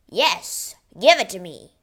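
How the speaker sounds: loud, grabbing giant's demand; booming greedy giant; greedy Cyclops. Acted.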